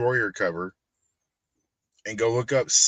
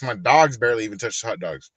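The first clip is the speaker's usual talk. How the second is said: ordinary talk, nothing marked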